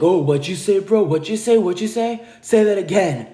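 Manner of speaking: mock tough guy. Man flexing his muscles in a confrontation, mock tough challenge. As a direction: mockingly puff up and demand a repeat. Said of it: "he starts flexing his muscles".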